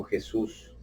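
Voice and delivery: breathy whispered name of Jesus; whispered prayer. Acted. Preacher praying at the cross, whispered.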